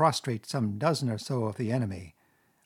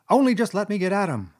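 high, louder seething Toad; angry mutter; 8 semitones higher, 6 dB louder than usual. A narrator's reading. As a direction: seethe through gritted teeth. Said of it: "muttered Toad, grinding his teeth".

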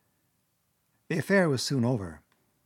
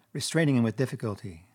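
ordinary delivery, nothing marked

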